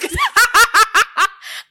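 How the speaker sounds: a burst of loud high laughter; real loud cackle. Real, not acted. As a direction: crack up loudly at the memory. Big laugh at quitting story.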